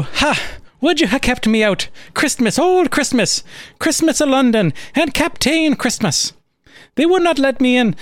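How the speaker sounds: high, hammed-up old Irish accent; Father Christmas protesting in a comic Irish accent. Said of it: "I'm gonna do the Lucky Charms accent here".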